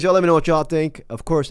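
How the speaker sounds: normal talking voice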